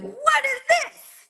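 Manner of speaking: very high, drawn-out mock squeal; hammed-up fairy godmother voice; exclaiming fairy godmother. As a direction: swoop in with a loud, stretched-out exclamation. Fairy godmother's startled arrival, acted.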